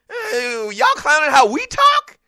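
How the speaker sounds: high-pitched, stammering comeback; hammed-up, worked-up teasing; mock-indignant clowning. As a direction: roast them back in mock outrage. Retelling his heated teasing comeback.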